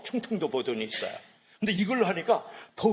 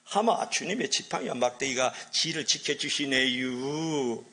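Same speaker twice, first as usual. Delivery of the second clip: slow Chungcheong drawl; Chungcheong accent; slower than usual. Shown as a demonstration. Preacher performing the Chungcheong version of the psalm, Chungcheong accent. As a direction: stretch every ending in lazy Chungcheong dialect. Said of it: "충청도 버전으로 한번 하겠어요".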